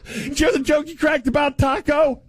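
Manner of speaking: hammed-up chuckling crowd; mock laughing. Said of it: "we just all sit back and go".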